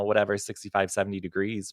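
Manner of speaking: usual speaking voice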